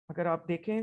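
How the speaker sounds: ordinary talk, nothing marked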